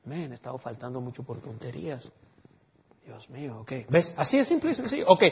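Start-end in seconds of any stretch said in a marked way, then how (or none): 0.1-3.7 much softer, half-whispered musing; hushed inner voice; inner thought voice. Pretend. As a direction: murmur it to yourself as a private realization. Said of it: "es como una impresión".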